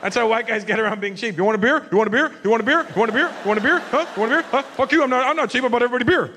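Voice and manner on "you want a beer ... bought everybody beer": loud, insistent, pitched up, repeating the offer; pushy loud beer offers. Acted. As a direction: hammer the beer offer like a guilty host.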